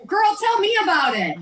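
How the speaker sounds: hammed-up diva, high and loud; sassy agreement. Real, not acted.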